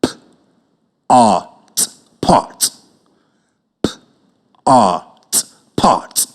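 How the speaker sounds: low drawn-out "P-art" blended into "pot"; sounding out. Shown as a demonstration. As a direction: stretch "P-art", then snap into "pot". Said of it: "Listen what I sound out the word".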